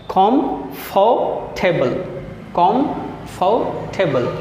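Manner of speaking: कॉम्फर्टेबल drawn out slowly, kom-far-te-bal; very slow syllable-split reading of कॉम्फर्टेबल. Shown as a demonstration. Teacher sounding out a three-syllable word slowly. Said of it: "three syllable में तोड़ दिया".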